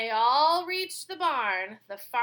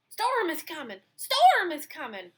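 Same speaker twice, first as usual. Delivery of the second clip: higher, urgent dog's alarm; barking dog; 6 semitones higher, 6 dB louder than usual. Acted. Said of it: "barked the dog".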